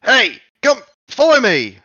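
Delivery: high, loud goblin shout; hobgoblin calling out. Acted. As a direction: call your friend over, alarmed and loud. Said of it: "you hear in Goblin".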